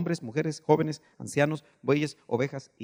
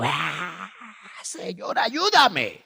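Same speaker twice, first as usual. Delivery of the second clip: high pleading cry for help; 4 semitones higher, much slower than usual. Acted. Believer crying out in private devotion, pleading cry. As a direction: cry out to God, rising on the plea. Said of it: "échese un grito".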